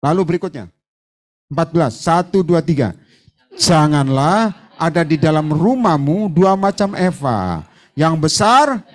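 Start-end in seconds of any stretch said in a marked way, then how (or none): 3.5-8.8 slow reading. Shown as a demonstration. Preacher leading the church in slow scripture reading. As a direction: read it slowly, word by word. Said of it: "Baca pelan-pelan".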